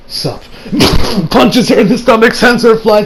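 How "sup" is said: low, quiet offhand greeting; casual sup. Retold or imitated. Goku greeting Kefla, low and quiet. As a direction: toss it off coolly, barely bothering. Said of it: "he's like".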